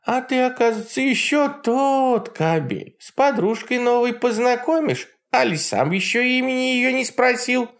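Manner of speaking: high mocking old witch; Baba Yaga voice. A narrator's reading. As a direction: sneer like a jealous hag. Yaga scolding.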